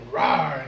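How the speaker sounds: mock roar. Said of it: "I yelled at him".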